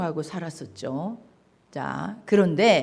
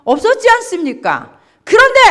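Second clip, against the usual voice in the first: much higher, loud outburst; indignant shout; 12 semitones higher, 10 dB louder, faster than usual. Acted. Elder son shouting his grievance at his father, loud. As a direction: burst out at the father. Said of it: "이렇게 따지는 거예요".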